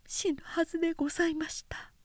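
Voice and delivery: soft, high whispered confession; modest whisper. A narrator's reading. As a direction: barely breathe it out, demure. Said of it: "つつましく答えた". (つつましい囁き声)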